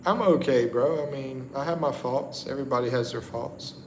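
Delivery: ordinary delivery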